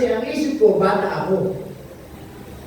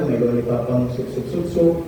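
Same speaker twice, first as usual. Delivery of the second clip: put-on much lower, babyish sup-sup-sup-sup slurping sounds; mock lollipop sucking; 8 semitones lower than usual. Preacher mocks a grown boy to the church, low slurping mimicry. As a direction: mimic a fifteen-year-old still slurping on candy.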